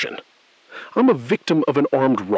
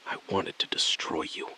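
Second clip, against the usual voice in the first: softer, barely voiced bitterness; bitter whisper; 5 semitones lower, 9 dB quieter than usual. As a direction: spit it out in a low whisper. Bitter quiet repeat.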